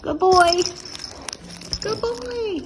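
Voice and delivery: high, bright praise for the dog; excited praise. Real, not acted. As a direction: cheer him on, delighted.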